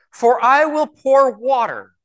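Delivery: "pour water" drawn out high; scripture reading leaning hard on "pour water"; emphatic inflection. Shown as a demonstration. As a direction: lift and slowly stretch "I will pour water". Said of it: "listening to my inflection, what I emphasize".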